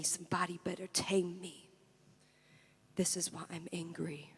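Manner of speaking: very soft, fading chant; hushed refrain. Acted. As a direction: let it sink almost to nothing. Refrain fades away.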